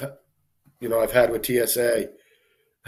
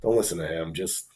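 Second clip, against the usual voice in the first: hushed aside; 5 semitones lower, 6 dB quieter than usual. Retold or imitated. Agents quietly calm him.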